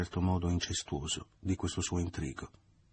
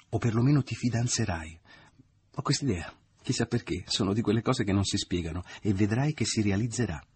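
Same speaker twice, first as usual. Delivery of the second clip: she almost sings her hunch; eager prediction; 4 semitones higher than usual. A narrator's reading. Mother excitedly predicting marriage. (la madre predice le nozze, eccitata)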